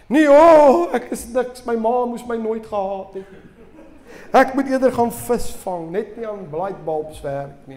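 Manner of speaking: a defeated inventor throwing in the towel, mock; hammed-up despair. Preacher acting Edison giving up, hammed-up wail fading. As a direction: wail high and loud at first, then deflate.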